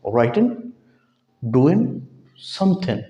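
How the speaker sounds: राइटिंग ending in a light न, no g; native -in ending. Shown as a demonstration. Teacher models how English speakers say -ing. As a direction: drop the g in समथिंग, end on न. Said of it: "आखिर में नून की आवाज़ देता है".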